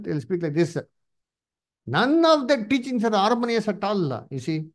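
usual speaking voice

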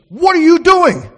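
high, loud, drawn-out mock shout; loud, high-pitched outraged question; mother's startled scolding. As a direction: act out a mother catching her child red-handed. Mother catches boy at cookie jar.

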